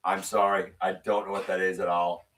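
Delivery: ordinary talk, nothing marked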